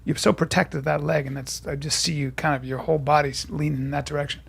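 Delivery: low, soft observation; acting coach impression. Coach studies a student's body after the scene, low and quiet. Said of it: "And he goes".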